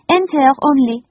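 put-on high, loud French-accented gush; French lady. A narrator's reading. The lady talks over the children, high loud French accent. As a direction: insist warmly in a French accent.

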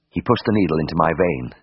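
ordinary delivery, nothing marked

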